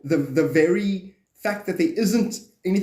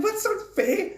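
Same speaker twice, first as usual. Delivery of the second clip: high mock protest; slower than usual. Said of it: "I'm like".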